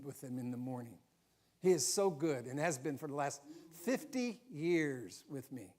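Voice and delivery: normal talking voice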